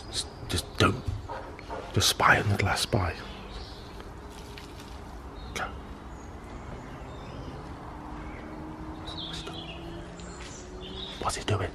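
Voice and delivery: hushed spying whisper. Real, not acted. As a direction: breathe it so he can't hear. Spying through the glass.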